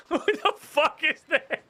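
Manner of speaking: laughing. Real, not acted. Laughing at weird figure.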